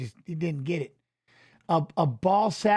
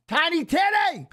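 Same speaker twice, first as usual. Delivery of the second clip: excited shout-out; 9 semitones higher than usual. Real, not acted. Streamer greeting Teddy in chat, calling out high. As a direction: sing out the name in greeting.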